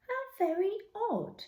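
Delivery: put-on high, quivery old lady voice; old lady in a deck chair, lightly voiced. A narrator's reading. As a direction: play a gentle old lady, amused. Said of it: "said the lady".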